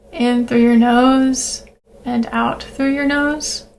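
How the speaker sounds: calm breathing-exercise voice with long pauses; guided meditation. Acted. Leads a grounding breathing meditation.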